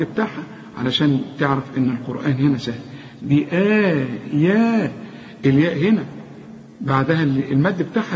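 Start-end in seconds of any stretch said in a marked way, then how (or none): 3.2-4.9 بآيا drawn out very slowly; slow even madd in بآيا; stretched vowels. Shown as a demonstration. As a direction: hold each long vowel in بآيا evenly. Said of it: "شوف المدود قد بعض إزاي".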